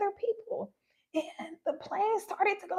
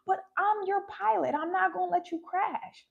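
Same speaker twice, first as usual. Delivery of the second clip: normal talking voice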